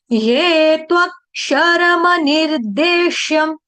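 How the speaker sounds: ये त्वक्षरम निर्देश्यम chanted slowly, each syllable drawn out; slow recitation. Shown as a demonstration. Teacher reciting the verse slowly for a student. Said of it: "देखो जैसे है".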